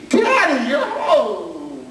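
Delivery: high, bright "Daddy, you're home" with a laugh; high, laughing child greeting; delighted child. Retold or imitated. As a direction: squeal it like a happy little girl. Child delighted to see dad.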